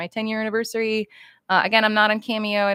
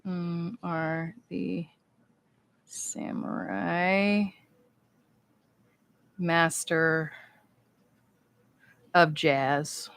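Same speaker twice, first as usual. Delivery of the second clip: very slow, drawn out as she writes; slow writing-aloud; 4 dB quieter, much slower than usual. Real, not acted. Signing a fan's card, slowly saying the words she writes. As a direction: stretch each word while writing it. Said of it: "I kinda just inverted your name".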